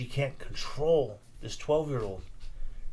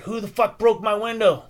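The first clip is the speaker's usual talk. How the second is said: put-on higher, louder angry yell at the kids; 8 semitones higher, 6 dB louder than usual. Neighbour yells at the kid about his broken window, angry. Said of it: "starts yelling and shit".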